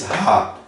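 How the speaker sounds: "Ra" with a breathy aspirated h. Shown as a demonstration. Teacher showing Dutch h to students, aspirated. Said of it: "Ele é meio aspirado".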